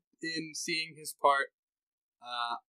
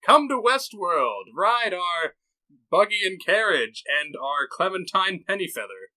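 mock park advert; 4 semitones higher, 6 dB louder than usual. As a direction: announce it like a park barker.

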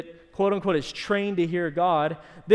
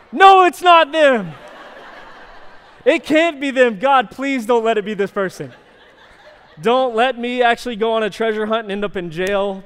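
panicked denial; 5 semitones higher, 10 dB louder than usual. Retold or imitated. Pastor begging God it isn't the woman, loud panicked pleading. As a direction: shout it in mock horror, begging it not to be her. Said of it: "And I'm like".

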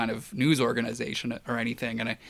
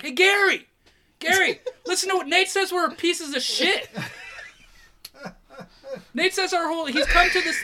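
imitating a call across. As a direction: hail a friend across the yard, hamming it up. Ron calling across the compound.

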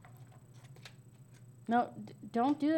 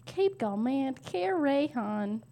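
slow, stretched syllables; odd sounding-out; slower than usual. Real, not acted. Player trying to hear the phrase differently, slow sounding-out. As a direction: draw each chunk out to hear it differently. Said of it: "Why are you saying it like that".

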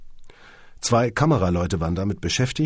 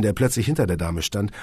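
plain reading voice

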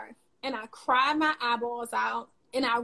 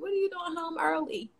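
imitating her kids; 5 semitones higher, 5 dB quieter than usual. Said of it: "my kids are like".

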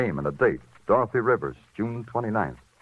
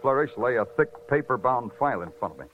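clipped hard-boiled voiceover, dry and level; noir narration; 5 semitones higher than usual. A narrator's reading. Detective narrates the case to the listeners, dry noir voiceover. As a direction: tell it like a tired cop looking back.

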